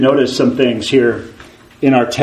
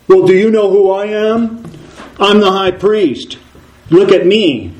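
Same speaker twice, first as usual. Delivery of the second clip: higher, pompous self-defense; proud high priest; 7 semitones higher than usual. Acted. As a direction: puff up like a priest pleading rank. Voicing Joshua's feeble defense.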